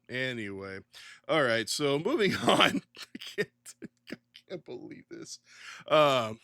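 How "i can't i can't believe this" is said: breathless, whispered under laughter; barely gets it out; whispered disbelief. Real, not acted. Stifling laughter over the news, whispered.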